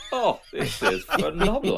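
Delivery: a lightly voiced Victorian chimney sweep; old London cockney. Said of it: "chimney sweep dance going".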